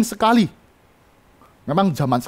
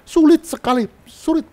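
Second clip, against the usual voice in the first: high, loud exclaim; 10 semitones higher, 3 dB louder than usual. Real, not acted. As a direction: cry out how hard.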